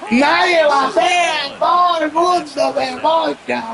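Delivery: high, slow yelled complaint; exasperated shout. Real, not acted. Fan yells that nobody can hit, high and drawn out. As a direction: throw the words out in disbelief.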